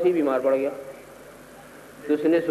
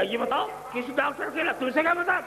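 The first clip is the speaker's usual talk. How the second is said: higher and higher angry question; rising annoyance; 9 semitones higher, 4 dB quieter than usual. Retold or imitated. Sick neighbour snapping at deaf visitor, rising anger. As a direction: let the irritation climb.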